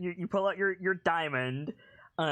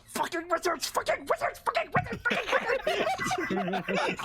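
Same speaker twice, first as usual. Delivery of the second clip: much higher, frantic goblin chant of curses, over and over; irate goblin ranting. Retold or imitated. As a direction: screech it again and again like a furious goblin. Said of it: "this irate goblin".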